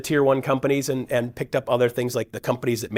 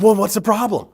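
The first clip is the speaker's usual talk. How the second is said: higher friendly voice; 9 semitones higher, 5 dB louder than usual. Retold or imitated. Cousin Rob voiced higher.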